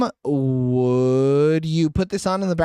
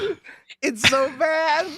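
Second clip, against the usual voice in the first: mock pleading; 9 semitones higher than usual. Friend hams up a movie scene, wailing high.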